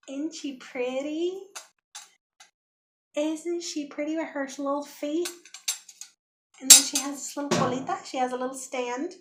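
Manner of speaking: high, sweet cooing over a treasure; cooing admiration. Real, not acted. Showing viewers her new metal find, cooing.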